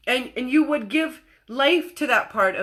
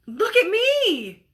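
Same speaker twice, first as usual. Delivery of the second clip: put-on high, slow little-girl voice, proud; 8 semitones higher, much slower than usual. Little girl to her mom, proudly showing off the dress. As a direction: play a small child showing off.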